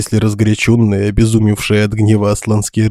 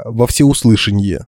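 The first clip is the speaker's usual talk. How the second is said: ordinary delivery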